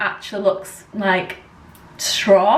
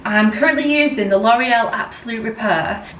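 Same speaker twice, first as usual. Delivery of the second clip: normal talking voice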